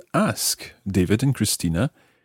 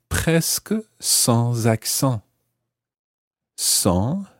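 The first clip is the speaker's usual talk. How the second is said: careful model phrase; 2 semitones higher, much slower than usual. Shown as a demonstration. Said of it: "teacher models the phrase".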